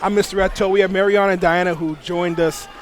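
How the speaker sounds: usual speaking voice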